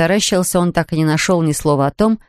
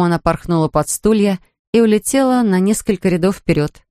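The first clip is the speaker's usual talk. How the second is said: ordinary delivery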